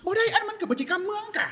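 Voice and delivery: high-pitched mock protest; arguing objector. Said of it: "เถียงกัน".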